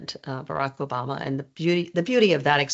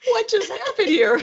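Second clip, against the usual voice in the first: high, louder mock astonishment; mock bafflement; 5 dB louder than usual. Press room baffled by the exchange, high mock bafflement. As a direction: pitch it way up, amused and incredulous. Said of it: "everybody's looking at me like".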